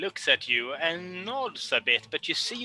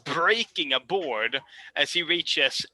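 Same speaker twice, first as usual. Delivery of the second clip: ordinary delivery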